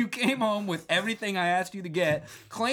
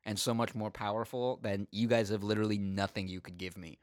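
lower, softer, smug put-down; arrogant Joseph; 8 semitones lower, 5 dB quieter than usual. Retold or imitated. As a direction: sneer it quietly from far above them. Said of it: "he's basically being like".

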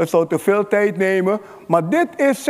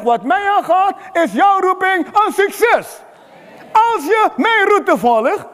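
full-force preaching; 7 semitones higher than usual. Acted. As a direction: proclaim it to the whole hall, slowing on key words.

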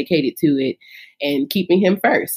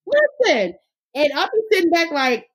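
excited; 11 semitones higher than usual. Real, not acted. Excited retelling of her success.

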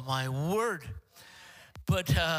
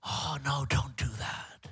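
lower, half-whispered discouragement; devil's whisper; 5 semitones lower, 3 dB quieter than usual. Acted. Preacher voicing the devil warning churchgoers off, half-whispered. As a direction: imitate the devil, dropping into a partial whisper. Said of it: "He's gonna whisper in your ear".

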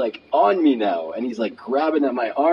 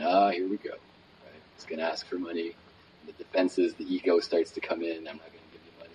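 quiet, lower aside to himself; muttered inner thought; 5 semitones lower, 7 dB quieter than usual. Retold or imitated. Wary man thinking as a homeless stranger approaches, muttered low. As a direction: trail off almost under the breath.

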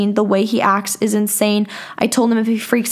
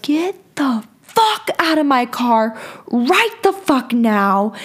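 exploding; 3 semitones higher, slower than usual. Retold or imitated. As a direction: drive out each word, mock-exploding. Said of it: "I just exploded".

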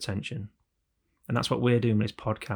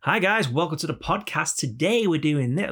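higher, put-on host greeting; fake podcast voice; 5 semitones higher, 3 dB louder than usual. Host opening his podcast, fake voice. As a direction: greet listeners in a fake presenter voice. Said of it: "Don't do the whole fake voice thing".

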